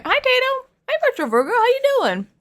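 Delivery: high sing-song hello; chirpy welcome to viewers; cheery greeting. Real, not acted. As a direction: greet the chat brightly.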